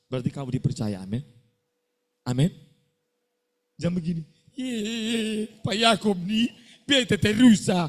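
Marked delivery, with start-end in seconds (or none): 4.6-7.9 mock complaining student.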